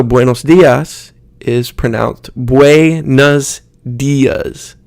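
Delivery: buenos broken up as bway-nuhz, dias as dee-uhz; Americanized pronunciation. Shown as a demonstration. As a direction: slowly sound out buenos dias with uh vowels. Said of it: "a sound kinda like uh".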